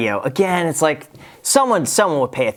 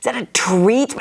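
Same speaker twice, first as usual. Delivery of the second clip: high, slow, excited voice for the pet; sing-song voice you use on a dog; dog-talk voice; 12 semitones higher, slower than usual. Retold or imitated. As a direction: coo at the dog about a treat. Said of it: "I do the same voice for the dog".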